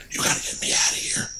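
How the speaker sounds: urgent voiceless whisper; whispered plea. Retold or imitated. Son secretly begging his mom, whispered. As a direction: hiss it to mom so the aide won't hear. Pleading to mom about aide.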